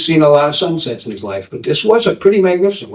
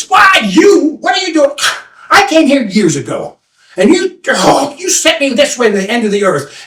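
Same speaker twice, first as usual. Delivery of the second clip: high, outraged outburst easing down; indignant confrontation; 10 semitones higher than usual. Acted. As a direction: accuse the old man who misled you. Seeker confronts the old man.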